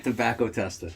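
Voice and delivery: "tester" rolled out low and soft, loose ending; off-the-hip pronunciation. Shown as a demonstration. Showing how it rolls out, casual "testa" for "tester". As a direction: let "tester" roll out of the teeth as "testa". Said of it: "how it rolls out of my teeth".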